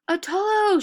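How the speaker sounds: high, loud scream of a name. A narrator's reading. Adis screaming for her husband to help, loud. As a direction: shriek his name across the house. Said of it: "she screamed".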